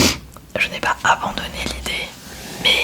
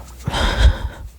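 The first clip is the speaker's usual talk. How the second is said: whispered laugh; 4 dB louder than usual. Real, not acted. Laughs at own struggle.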